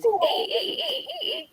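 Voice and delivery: very high squeak fading to soft; laughing imitation of a squeaky toy; toy bunny squeak. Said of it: "That little bunny was squeaking like crazy".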